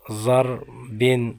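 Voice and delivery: ضربن drawn out very slowly, ending in nasal -in; very slow recitation demo. Shown as a demonstration. Teacher showing the class the tanween ending, very slow. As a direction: stretch ضربن out syllable by syllable. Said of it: "ضرب کہہ کے بھی رکھ سکتے ہیں".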